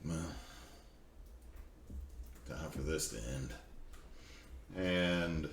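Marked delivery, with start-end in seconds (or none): 0.0-3.5 low, soft, weary resolve; understated grim character line. Acted. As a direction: say it quietly and lower, pausing after the first word. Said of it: "look at this and go".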